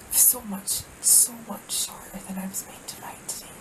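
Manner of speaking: hushed breathless whisper. Real, not acted. Alone in bear country at night, whispering to camera. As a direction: whisper as if a bear might hear. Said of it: "I don't know why I'm whispering".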